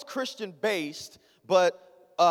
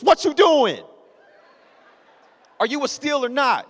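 angry dad; 6 semitones higher, 7 dB louder than usual. Retold or imitated. Dad's halftime pep talk.

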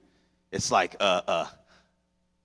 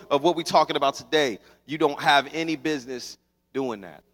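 usual speaking voice

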